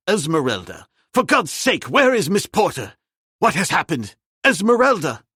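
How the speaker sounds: loud urgent calls, rising to a cry; frantic shouting at the fainted maid; desperate calling. A narrator's reading. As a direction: shake her awake by shouting her name.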